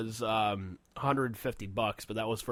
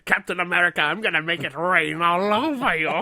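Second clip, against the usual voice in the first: hammed-up villain; 6 semitones higher, 8 dB louder than usual. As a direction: bellow it like a ridiculous comic-book villain. Acts out comic villain threatening hero.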